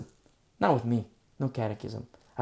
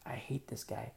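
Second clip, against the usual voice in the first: ordinary delivery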